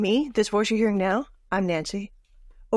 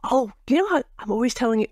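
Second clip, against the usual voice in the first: normal talking voice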